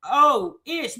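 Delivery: normal talking voice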